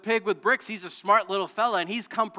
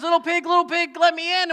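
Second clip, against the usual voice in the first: higher, louder wolf calling at the door; big bad wolf; 10 semitones higher, 8 dB louder than usual. Pastor voicing the wolf at the straw house, high and loud. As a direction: call sweetly but hungrily to the pig inside. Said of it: "And he says".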